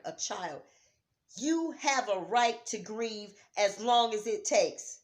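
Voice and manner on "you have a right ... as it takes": slow, loud and higher; emphatic declaration. Real, not acted. Vlogger telling mourners they may grieve, slow loud declaration. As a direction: lay it down as a firm truth. Climax of grief message.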